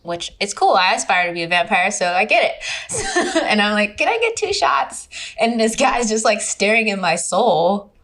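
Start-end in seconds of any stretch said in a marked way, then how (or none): 4.0-5.1 high-pitched, sweet little ask; high, tentative customer voice; retold polite request. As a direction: act out ordering, voice lifted high. Ordering from a staring bartender.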